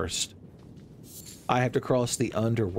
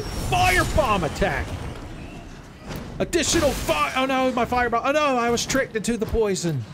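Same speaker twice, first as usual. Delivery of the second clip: frantic shouting; 11 semitones higher than usual. Real, not acted. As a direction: holler each move in a panic. Shouting during fight.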